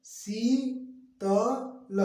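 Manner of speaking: high, very slow शी, त, ल; spelled-out slow reading; syllable by syllable. Shown as a demonstration. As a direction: hold शी, then place त and ल apart. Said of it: "मिला के".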